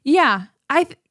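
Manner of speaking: ordinary delivery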